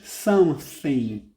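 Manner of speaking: Something with the th said slowly, tongue between the teeth. Shown as a demonstration. Teacher showing students the English th, slow and careful. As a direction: stretch out Something, pushing the th through the teeth. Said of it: "coloque a língua entre os dentes".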